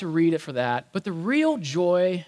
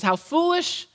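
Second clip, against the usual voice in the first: mock rebuke; 10 semitones higher, slower than usual. Jesus rebukes slow disciples.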